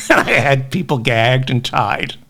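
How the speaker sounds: giggling through the words; laughing. Real, not acted. Host cracking up at his own dark basement joke, laughing. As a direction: chuckle as you picture it, breaking up at the end.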